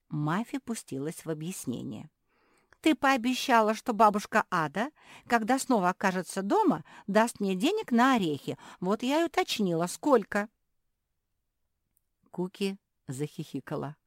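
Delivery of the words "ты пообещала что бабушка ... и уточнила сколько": put-on high, loud childlike character voice; eager little pug, explaining.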